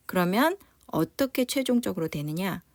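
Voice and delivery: usual speaking voice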